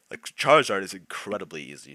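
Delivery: talking through a yawn; words stretched by a yawn. Real, not acted. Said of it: "Sorry for the yawn".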